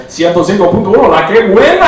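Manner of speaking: loud, fast, hyped Spanish radio announcer; Mexican radio DJ voice. Retold or imitated. Mock radio DJ hyping the station to his dog. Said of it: "doing that voice to my dog".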